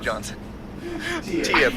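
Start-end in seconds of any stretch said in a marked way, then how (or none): 1.2-1.8 higher, louder, laughing through words. Real, not acted. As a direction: crack up while talking. Laughing at the Rock reveal.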